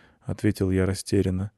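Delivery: ordinary delivery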